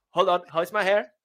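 usual speaking voice

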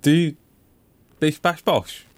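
high, questioning goblin voice; lightly voiced strange little man; odd little creature voice. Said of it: "he asked Nathan".

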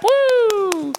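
a sudden high cheering whoop. Retold or imitated. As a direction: whoop like the congregation. Crowd cheering a testimony.

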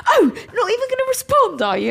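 mock him like a sulky child; imitating the boyfriend's whiny complaint; whiny mockery.

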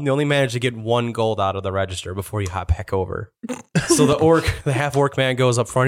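ordinary delivery

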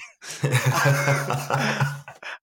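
real burst of loud laughter; loud laugh at the story. Real, not acted. Laughing at the mix-up.

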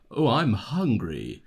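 slow, drawn-out British delivery; plummy British take, stretched out; British accent. Shown as a demonstration. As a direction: say it as a very British person. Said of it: "picture how a British person would say".

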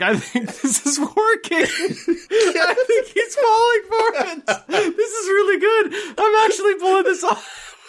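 high, squeaky-pitched glee through laughter; laughing mock inner monologue. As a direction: barely get the imagined thoughts out for laughing. Mocking actor's imagined thoughts while laughing.

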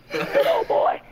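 different character sound. Shown as a demonstration. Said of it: "It just is a different sound from you go from this".

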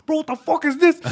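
high, loud mock outrage; retold outraged outburst. Buddy spins round at the fight, loud outraged yell.

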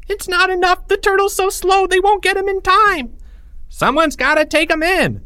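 put-on high-pitched frantic kid voice; urgent squealing child; panicked kid. A narrator's reading. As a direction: cry out in alarm, very high. Panicked kid shouts in story.

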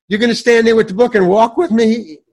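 high, loud, incredulous mock protest; exaggerated indignation. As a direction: blurt the question as if appalled. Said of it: "I didn't say it like that".

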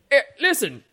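high, loud, excitable voice; hammed-up high-pitched urgency; manic stable man. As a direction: cut in as the overwrought stable man. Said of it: "but he goes".

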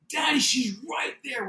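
put-on high-pitched child voice; imitating urgent daughter. Daughter tells father where the lady is, imitated high urgency. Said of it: "She says".

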